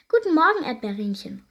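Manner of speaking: high, loud cheery call. A narrator's reading. Blueberry fairy greets over fence, loud high call. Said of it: "ruft Heidi der Schabe zu".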